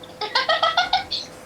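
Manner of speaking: squealing burst of laughter; high laugh. Real, not acted. Friend laughs at call prank.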